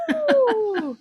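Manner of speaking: loud laughing cuckoo; cuckoo call. Acted. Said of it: "random animal sounds".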